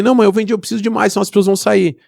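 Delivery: put-on boy's high excited plea to mother; eager child.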